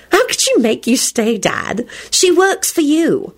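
put-on high-pitched girlish teasing; teasing daughter. A narrator's reading. Rosie questioning dad about his secretary, teasing. Giggling daughter teases her father.

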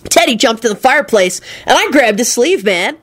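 happy, lilting stoner explanation; blissful dude. A narrator's reading. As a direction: recount it blissfully. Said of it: "Kenny finished blissfully".

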